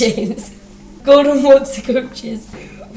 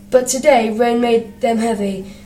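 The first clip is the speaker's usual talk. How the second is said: ordinary delivery, nothing marked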